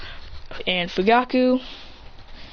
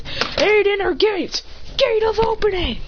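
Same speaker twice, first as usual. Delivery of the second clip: high, drawn-out anime move call; attack call; 10 semitones higher, slower than usual. Acted. Hero powering up against the bullies, high attack call. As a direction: call the technique slowly, pitched up.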